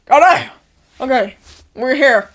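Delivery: loud startled oh no; excited shout. Retold or imitated. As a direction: blurt it out in shock. Retold shock of arriving.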